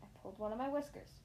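ordinary delivery, nothing marked

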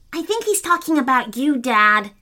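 ordinary delivery

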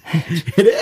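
laughing while agreeing; amused, giggling through it. Real, not acted. Laughing agreement at Doctor Who.

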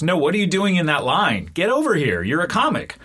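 high, insistent mock call; retold shout. Comedian calls a newcomer out of the line, mock-insistent shout. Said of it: "he shouts at me".

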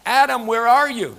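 loud call as God, searching for Adam. Acted. Preacher voicing God calling Adam, loud call. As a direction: call his name out across the garden.

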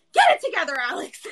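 high, loud comic self-scolding; mock yell, dropping on the name; retold yelling. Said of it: "my higher self yelling at me".

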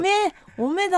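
high-pitched Akita dialect role-play. Pretend. Greeting a classmate at mock reunion, Akita dialect. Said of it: "ちょっと秋田弁で話してみたい".

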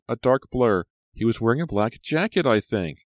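put-on high, agitated girl's voice; distressed. A narrator's reading. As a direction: recall it anxiously, pitched high. Shaken Maya describes the attacker.

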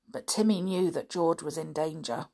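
ordinary delivery

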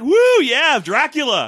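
loud, high whooping cheer; cheering crowd. Pretend. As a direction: whoop like a thrilled fan. Audience cheering the villain.

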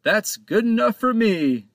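high, loud, cheerful shout; captain shouting happily to Panto. A narrator's reading. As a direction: call it out with gusto. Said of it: "he shouted".

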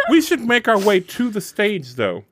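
usual speaking voice

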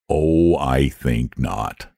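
very slow, low and lazy. A narrator's reading. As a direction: drawl it without a care. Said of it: "in his lazy way".

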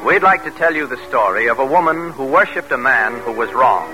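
usual speaking voice

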